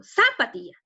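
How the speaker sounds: "zapatilla" front-loaded on a punched ZA; wrong stress on ZA. Shown as a demonstration. Teacher repeating the mistake for pupils, stress on ZA in "zapatilla". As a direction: put all the weight on opening ZA in "zapatilla". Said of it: "está en sa".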